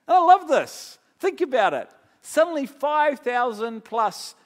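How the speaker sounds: sudden loud, high burst of delight; excited outburst. Real, not acted. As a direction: shout your joy at the story.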